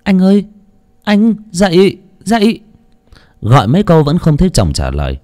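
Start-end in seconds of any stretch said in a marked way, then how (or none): 0.0-2.6 insistent high-pitched calling; wife calling husband. A narrator's reading. Wife trying to wake silent husband, insistent high call. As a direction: keep calling high to rouse someone unresponsive. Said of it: "Bà Ngọc lại gọi".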